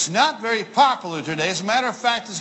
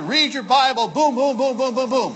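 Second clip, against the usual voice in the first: higher, rattled-off booms in a chant; mock hurried advice; 4 semitones higher than usual. Preacher mimics the world's hurried Bible advice, mock brisk. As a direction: parody of rushing through the reading. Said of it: "everybody in the world tells you".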